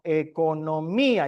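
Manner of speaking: "economía" drawn out slowly, weight on the í; stress on the I. Shown as a demonstration. Preacher showing where the accent falls, stress on the í of "economía". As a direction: stretch "economía", stressing the í before the final a. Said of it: "lleva acento en la I".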